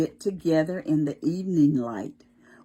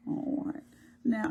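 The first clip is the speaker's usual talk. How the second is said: usual speaking voice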